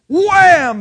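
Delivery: high loud burst on wham. Pretend. Preacher acting out pointing the finger, loud high wham. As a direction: punch out the wham.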